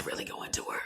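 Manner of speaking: soft whisper about something she can't say; hushed, guarded confession. Real, not acted. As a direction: drop to a secretive whisper. Can't talk about job trouble.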